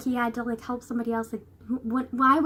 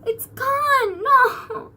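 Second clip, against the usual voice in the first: slow, high, teary wail rising louder; near crying; 9 semitones higher, 5 dB louder, much slower than usual. Real, not acted. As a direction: let it break into a whiny cry. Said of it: "I'm so upset".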